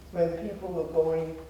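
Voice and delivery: ordinary talk, nothing marked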